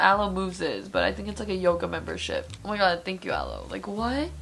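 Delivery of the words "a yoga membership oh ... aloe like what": usual speaking voice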